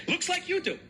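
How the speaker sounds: high sneering quip; sarcastic taunt; mocking jab. Real, not acted. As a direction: needle him.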